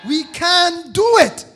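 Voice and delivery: slow, loud and high; can-do declaration. Acted. Preacher declaring to the congregation, slow loud can-do cry. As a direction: hammer each word home.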